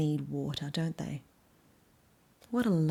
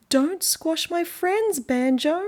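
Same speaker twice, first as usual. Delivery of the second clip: much higher, very slow tiny beetle; lady beetle voice; 10 semitones higher, 5 dB louder, much slower than usual. Acted. Lady beetle asking Banjo to spare her friends, high and slow. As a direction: squeak it out like a little bug pleading. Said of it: "They said".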